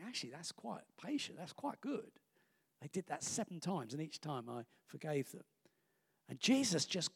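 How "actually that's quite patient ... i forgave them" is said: soft, much quieter inner voice; self-satisfied thought. Retold or imitated. Someone congratulating himself on forgiving, soft self-satisfied murmur. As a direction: murmur it smugly to yourself. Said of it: "You think".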